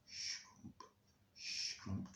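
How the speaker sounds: soft swishing crunch of footsteps; shoes on grass. Acted. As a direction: imitate shoes brushing through grass, hushed. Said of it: "The sound of our shoes on the grass".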